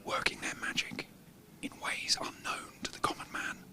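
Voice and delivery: hushed wildlife narrator whisper; nature documentary. Pretend. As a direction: narrate like observing rare animals. Sneaking in on working editors.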